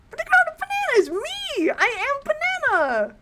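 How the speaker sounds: very high, squealed declaration; high-pitched, over-the-top proclamation; hammed-up banana cry. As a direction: shriek it theatrically, drawing out the end. Quotes meme in hyped voice.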